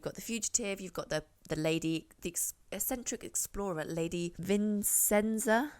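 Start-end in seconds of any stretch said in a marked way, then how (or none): 3.8-5.7 put-on slow, playful French accent on the name; a hammed-up French accent for a fancy lady's name; mock French accent. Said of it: "I don't know why I said that French".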